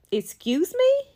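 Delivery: high, rising affronted question. Retold or imitated. Woman reacting to his offer for both women, affronted.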